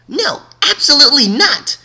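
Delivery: high, loud, harsh refusal; furious high-pitched shout of refusal. Acted. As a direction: slam the door on the idea with a yell. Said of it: "shouted one voice in a harsh tone".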